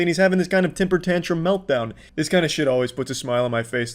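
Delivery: ordinary talk, nothing marked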